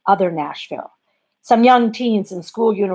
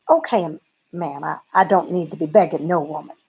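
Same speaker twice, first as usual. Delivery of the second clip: ordinary delivery, nothing marked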